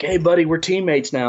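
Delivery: put-on high, eager young voice; excited impression. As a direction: sound thrilled and eager. Nubs excited on phone.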